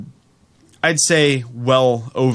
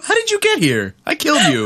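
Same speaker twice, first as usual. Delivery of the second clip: much higher, louder put-on voice; mock shocked character; 4 dB louder than usual. Co-host acting a game character who met someone he killed, high mock shock. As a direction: squeal it like a startled villain.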